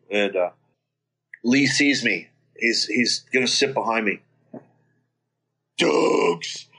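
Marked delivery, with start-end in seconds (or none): 5.8-6.7 put-on drunk declaration. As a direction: gush it out like a sloppy drunk. Drunk friend hugging narrator.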